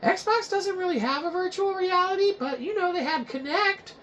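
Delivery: high, whiny fan voice; a know-it-all viewer correcting the host; nitpicking viewer. Acted. Viewer correcting Shiza Box in a high whiny voice. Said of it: "And you might be saying, like".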